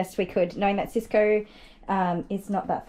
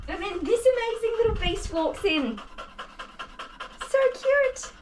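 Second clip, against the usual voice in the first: gushing; 12 semitones higher than usual. Real, not acted. Gushing about the dog.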